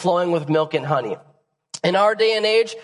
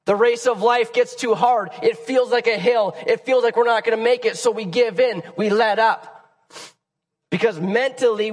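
normal talking voice

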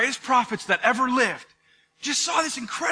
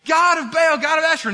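loud, higher, pleading call to a god; 6 semitones higher, 4 dB louder than usual. Acted. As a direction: cry out to the heavens. Prophets calling again, mocked.

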